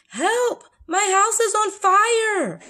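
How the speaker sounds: high, loud, drawn-out shout; alarmed cry for help. Shown as a demonstration. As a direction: yell it in hammed-up panic. Reading an exclamation example.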